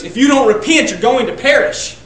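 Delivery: high, loud warning: If you don't repent. Retold or imitated. Preacher quoting Jesus to worldly Christians, loud warning. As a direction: cry it out as a stern warning. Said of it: "like Jesus".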